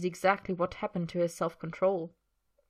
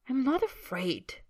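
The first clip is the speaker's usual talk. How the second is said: higher, drawn-out indignant protest; hot denial; 6 semitones higher, slower than usual. Acted. Man denies fearing the house-elf, mildly indignant. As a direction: deny it with a touch of heat. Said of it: "Draco says hotly".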